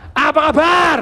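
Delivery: loud, high hype shout; rousing call. Real, not acted. Trainer calling to audience for their reply, rousing shout. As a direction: fire up the whole room.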